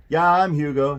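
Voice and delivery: put-on loud butcher with a German accent; heavy German accent. A narrator's reading. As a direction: answer the phone in a hearty German accent. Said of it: "said a voice in a heavy German accent".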